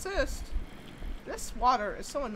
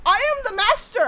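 high, loud grandiose proclamation; mock villain boast; 9 semitones higher, 9 dB louder than usual. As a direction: crow it like a cartoon overlord.

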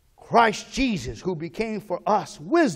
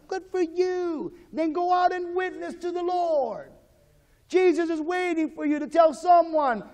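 high fervent exhortation; 8 semitones higher than usual. Real, not acted.

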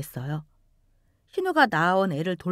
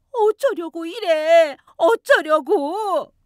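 slow, high, loud wailing question; a mother's anguished cry at her daughter; despairing lament; 8 semitones higher, 6 dB louder, much slower than usual. Acted. Mother wailing at her daughter over the baby, despairing.

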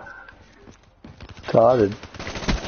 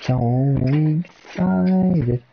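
drawn out; much slower than usual. Real, not acted. Stretching out his chant.

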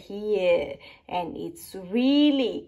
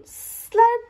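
slurp; 13 semitones higher, 6 dB louder than usual. Acted. Neptune sound words.

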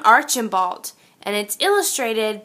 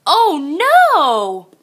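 mock dismay; 4 semitones higher, 4 dB louder than usual. Letters fall off tree.

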